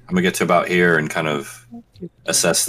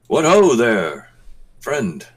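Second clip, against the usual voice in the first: hearty greeting; 6 semitones higher, 3 dB louder, much slower than usual. Acted. Player calls out to stranger.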